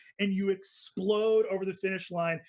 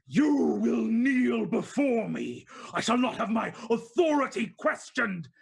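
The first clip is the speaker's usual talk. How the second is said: commanding Dawn Queen; imperious queen; 3 semitones higher, 5 dB louder than usual. Acted. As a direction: demand they kneel before her. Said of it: "the queen ... go".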